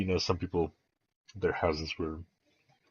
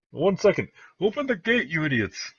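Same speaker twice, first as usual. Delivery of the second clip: high, raised yell of impatience; Jarrett yelling orders; 10 semitones higher, 3 dB louder than usual. Acted. Jarrett orders the keep gate opened, high impatient yell. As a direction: call sharply down to the gatekeepers. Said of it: "looks down at you and says".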